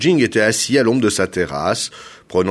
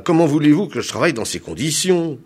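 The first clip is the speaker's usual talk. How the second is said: exasperated, raised complaint; scolding; 7 semitones higher, 4 dB louder, faster than usual. A narrator's reading. Hair planter chiding the governor for wriggling, scolding. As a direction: chide a fidgeting client. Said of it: "le gronda Agabunda".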